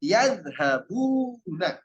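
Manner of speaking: slow, drawn-out Yadhabuna with the dh clearly bitten; careful Arabic model word. Shown as a demonstration. Teacher modelling Yadhabuna slowly for students. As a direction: sound out Yadhabuna slowly for the class. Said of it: "Jangan ya di awal, waw dan nun di akhir".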